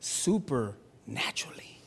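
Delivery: slow, hushed whisper of supernaturally. Real, not acted. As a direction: breathe Supernaturally out as a secret. Awed single word after pause.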